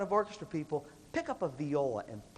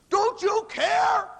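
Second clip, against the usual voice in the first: high, loud, stretched-out mock yell; imitated yelling; 9 dB louder, much slower than usual. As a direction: yell it at them, dragging out every word. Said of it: "we yell stupid things".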